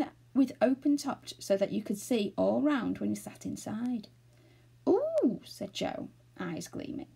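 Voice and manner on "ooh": high, drawn-out gasp of wonder; thrilled high exclamation; awed delight. A narrator's reading. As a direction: coo in amazement, pitched way up. Joe sees the aeroplane, eyes gleaming.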